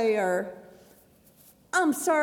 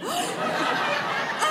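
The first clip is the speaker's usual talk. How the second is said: startled gasp; 11 semitones higher than usual. Acted. Sarah wakes startled.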